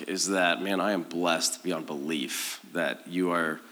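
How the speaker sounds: ordinary delivery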